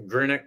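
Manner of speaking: Greenock said as GRIN-ick, short i and ick ending; suggested local pronunciation. Shown as a demonstration. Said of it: "they do say".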